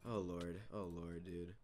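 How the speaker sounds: low, soft, deflated murmur; quiet dismay. Real, not acted. Gamer stunned by the loss, quiet dismay. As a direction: sigh the words out low and small.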